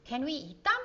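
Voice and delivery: put-on high, bright little girl asking; young girl full of wonder; child's eager question. A narrator's reading. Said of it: "asked Donna".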